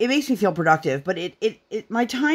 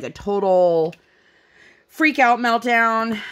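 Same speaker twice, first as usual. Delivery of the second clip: normal talking voice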